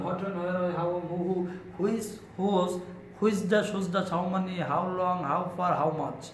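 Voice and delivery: quick-fire drill list, punched out; rapid reading. Shown as a demonstration. Teacher showing learners how fast to read the question words, rapid reading. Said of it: "খুব দ্রুত আপনার পড়তে হবে".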